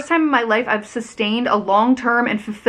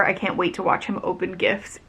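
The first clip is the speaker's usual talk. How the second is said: ordinary delivery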